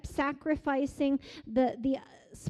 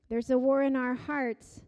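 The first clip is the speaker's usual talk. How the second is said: usual speaking voice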